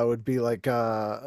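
ordinary talk

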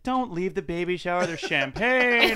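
high, drawn-out champagne plea; mock pleading wife. Wife begging him not to leave the baby shower, mock pleading. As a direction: imitate a wife calling you back, stretching the last word. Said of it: "my wife's like".

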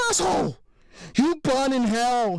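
high-pitched furious woman's cry; tearful outburst. A narrator's reading. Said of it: "she said through tears".

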